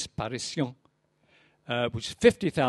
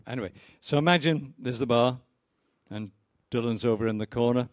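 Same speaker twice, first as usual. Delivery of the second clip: ordinary delivery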